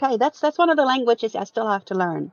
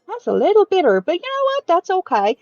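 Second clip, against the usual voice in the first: brave cheer; 7 semitones higher, 4 dB louder than usual. Acted. Tasting bitter coffee bravely.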